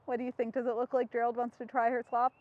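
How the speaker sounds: ordinary delivery, nothing marked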